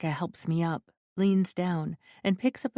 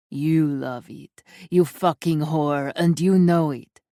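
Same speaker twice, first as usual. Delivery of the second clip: grind it in, getting louder; taunting snarl; 2 semitones lower, 7 dB louder than usual. A narrator's reading. Abuser taunting Valeska.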